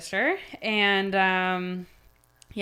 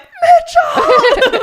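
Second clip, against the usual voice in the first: high, loud laughing shout of a name; excited shout; 14 dB louder than usual. Retold or imitated. Wife calling her husband to the window, a high laughing shout. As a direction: call out to him, laughing and thrilled.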